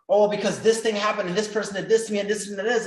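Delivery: high, loud rush of self-pity; whiny complaining. Retold or imitated. Young man venting his troubles to his mentor, loud and whiny. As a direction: pour out every grievance at once.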